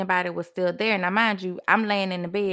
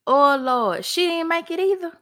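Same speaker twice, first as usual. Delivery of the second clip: high, louder retold cry of dread; panicked despair; 8 semitones higher, 3 dB louder than usual. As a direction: blurt it out as if all hope is gone. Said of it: "I'm losing it".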